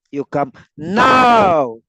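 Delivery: "now" loud and drawn out long; stress on NOW. Shown as a demonstration. Teacher meaning not afterwards, NOW stretched loud. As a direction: lean on "now" with a big stretched emphasis. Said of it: "Now means not afterwards".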